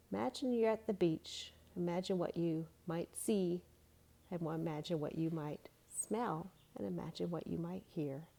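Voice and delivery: fading into a soft hush; guided imagery. Shown as a demonstration. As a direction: draw them into the picture.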